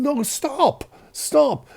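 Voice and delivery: high-pitched pleading to stop; alarmed protest. Retold or imitated. Son interrupting his mother's phone call, alarmed protest.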